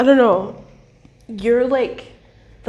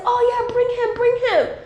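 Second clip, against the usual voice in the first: much higher, eager invitation; eager friends; 13 semitones higher than usual. Retold or imitated. Friends inviting the fun husband along, eagerly high-pitched. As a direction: beg them to bring him along. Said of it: "they're always like".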